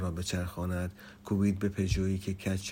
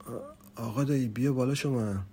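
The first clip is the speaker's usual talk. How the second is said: calling out; 5 semitones higher, 4 dB louder than usual. A narrator's reading. Narrator invites uncle up, louder.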